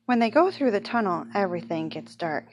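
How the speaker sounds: ordinary delivery, nothing marked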